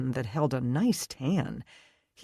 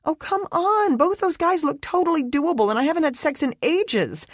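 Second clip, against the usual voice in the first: higher, louder, pleading and giddy; eager girlfriend voice; 14 semitones higher, 5 dB louder than usual. A narrator's reading. Brittany begging Leah to let her go, giddy and high. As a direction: beg like a boy-crazy best friend.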